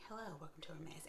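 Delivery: very soft, nearly breathless hello from the shop staff; imitating the staff's hushed welcome; hushed greeting. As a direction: barely voice it, as the staff whisper. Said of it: "they're talking like".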